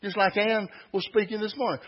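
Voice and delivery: ordinary talk, nothing marked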